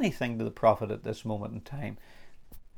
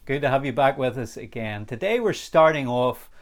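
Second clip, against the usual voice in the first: ordinary delivery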